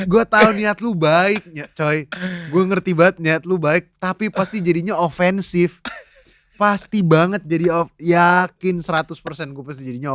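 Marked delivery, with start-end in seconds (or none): none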